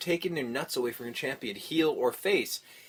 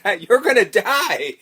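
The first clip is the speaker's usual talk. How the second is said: laughing shout; 7 semitones higher, 11 dB louder, slower than usual. Retold or imitated. Finishing the retold panicked warning.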